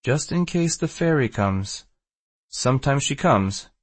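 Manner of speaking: put-on Maltese accent. A narrator's reading. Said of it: "continues in his Maltese accent".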